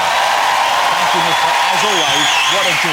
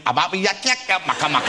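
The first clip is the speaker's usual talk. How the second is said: higher, teasing gibberish imitation; mock garbled gibberish; 4 semitones higher than usual. Host teases guest about what she said, mock gibberish imitation. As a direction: mimic her mangled accent as nonsense syllables. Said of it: "You went".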